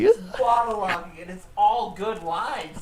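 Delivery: excited. Real, not acted. Gushing about Mac's lines.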